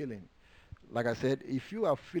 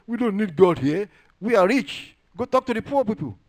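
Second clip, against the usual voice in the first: loud, high mock boast; boastful rich; 7 semitones higher, 9 dB louder than usual. Preacher voices the rich scorning God and the poor, boastful.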